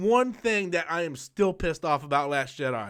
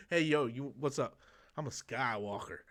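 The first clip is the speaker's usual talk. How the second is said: much softer, lower mock come-on; mock pickup line; 6 semitones lower, 11 dB quieter than usual. Guy hitting on a girl in a cantina, low and soft.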